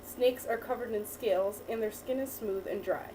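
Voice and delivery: ordinary talk, nothing marked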